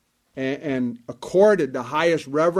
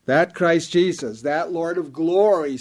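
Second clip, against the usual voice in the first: ordinary delivery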